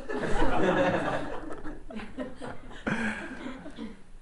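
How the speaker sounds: warm sustained laugh; real laughter. Real, not acted. Preacher laughing at the nativity punchline.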